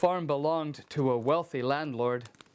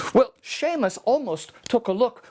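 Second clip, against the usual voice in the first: plain reading voice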